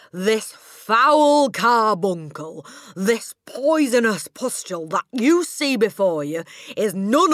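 slow, very loud, high-pitched tirade; booming. A narrator's reading. Headmistress denouncing the boy as a criminal, booming. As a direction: boom out each insult with relish. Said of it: "boomed the headmistress".

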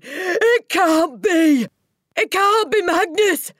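very high, loud, slow wail; horrified cry. A narrator's reading. Headmistress refusing to believe it, crying out. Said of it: "she cried".

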